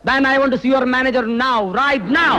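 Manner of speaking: high, slow, forceful demand; insistent demand. Acted. Officer demanding the manager from a security guard, insistent.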